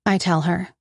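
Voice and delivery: ordinary delivery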